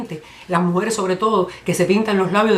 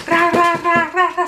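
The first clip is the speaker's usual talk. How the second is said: high, rapid-fire rolled "rra"; imitated fly swatting; 10 semitones higher, 3 dB louder, slower than usual. Comedian imitating woman with a flyswatter, frantic swatting noises. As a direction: swat away with repeated high trills.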